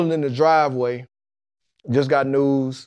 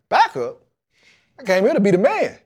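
higher-pitched, retold indignant disbelief; 8 semitones higher, 3 dB louder than usual. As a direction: react like you've just been insulted with a demotion. Retelling reaction to demotion.

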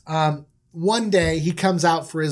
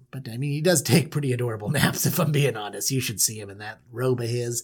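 doting dad; 8 semitones lower, 4 dB quieter, faster than usual. Acted. Jacob fondly defends his son.

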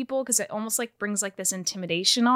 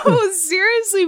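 high, loud laugh through the words; 10 semitones higher, 6 dB louder than usual. Real, not acted. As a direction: try to turn serious while still laughing. Laughing at host's silly chant.

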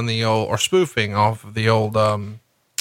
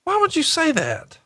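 high, squeaked-up question; mock shock. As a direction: play scandalized, pitch way up.

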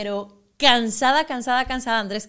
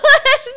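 loud burst of laughter; 12 dB louder than usual. Real, not acted. Bursts out laughing mid-sentence.

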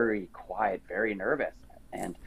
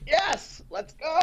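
eager yes; slower than usual. Retold or imitated. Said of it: "I was like".

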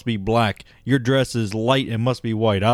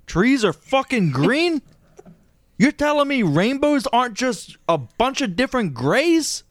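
very high, incredulous squeal of disbelief; hammed-up bewilderment, pitched way up; mock astonishment. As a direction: act out a mind being blown. Acting out world discovering colour.

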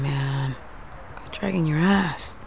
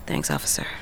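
muttered thanks; 10 dB quieter than usual. Acted. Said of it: "What was that?".

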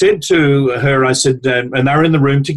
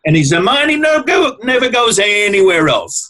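higher, laughing mock Italian accent building to a proud shout; laughing Italian grandmother; 6 semitones higher, slower than usual. The Italian wife boasting about her husband, laughing into a proud call. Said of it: "little square Italian woman".

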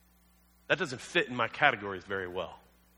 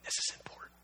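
whispered, breathy emphasis; hushed aside; 12 dB quieter, faster than usual. Real, not acted. Pastor leans in to the congregation, whispering. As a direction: lean in and whisper the point. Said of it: "pastor stresses the point".

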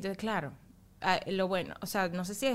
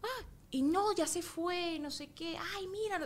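put-on high, child-directed wonder, softening as it goes; redirecting a toddler; 7 semitones higher than usual. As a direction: coax attention away in a lifted voice. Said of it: "redireccionar la atención".

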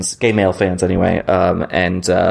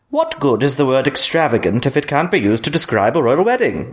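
higher, swooping theatrical voice; Lady Olenna; 5 semitones higher than usual. Acted. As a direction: play a haughty grande dame with sweeping intonation. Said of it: "your Lady Olenna impression".

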